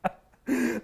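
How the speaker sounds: laughing refusal, slow and soft. Real, not acted. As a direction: laugh it off, drawn out. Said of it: "Do the rest in Scottish".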